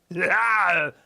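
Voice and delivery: high, loud goofy character laugh; cartoon beaver laugh. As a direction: cackle in the beaver voice. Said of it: "Can we hear a, a Viking beaver?".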